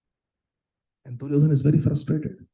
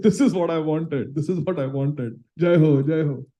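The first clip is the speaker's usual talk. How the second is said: ecstatic; 3 semitones higher, 3 dB louder than usual. Acted. Duryodhan dancing in ecstasy.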